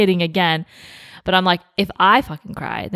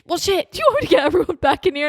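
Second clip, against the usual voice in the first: lightly voiced sister, higher and fast; quick offhand sister, pitched up; retelling the sister's quick offer, higher and fast; 11 semitones higher, faster than usual.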